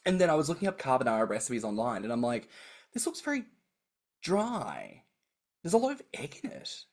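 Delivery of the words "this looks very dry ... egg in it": high, soft, hesitant doubt; retold puzzlement. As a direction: puzzle over it in a small high voice, with pauses. Retelling puzzlement at recipes.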